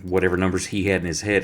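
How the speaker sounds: ordinary talk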